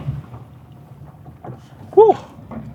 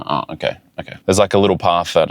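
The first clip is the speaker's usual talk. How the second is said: usual speaking voice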